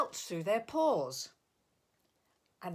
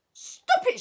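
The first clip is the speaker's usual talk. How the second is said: high, loud cry; 10 dB louder than usual. A narrator's reading. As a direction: shout to stop them. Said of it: "she cried".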